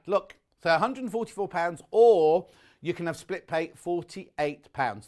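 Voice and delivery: normal talking voice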